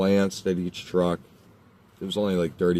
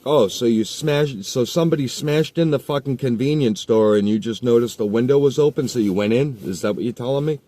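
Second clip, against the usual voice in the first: sarcastic challenge; 4 semitones higher than usual. Retold or imitated. Mocking the kid's excuse, sarcastic and raised. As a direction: lay out the excuse with scorn. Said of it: "I'm like".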